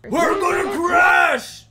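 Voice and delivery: screaming in terror, high and loud; panicked scream. Acted. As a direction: yell it like the plane is going down.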